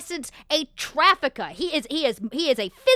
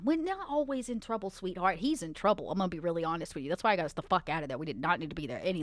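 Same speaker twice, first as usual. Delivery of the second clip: soft reassurance; 7 semitones lower, 8 dB quieter, faster than usual. Acted. Janet calms Elspeth, calling her sweetheart.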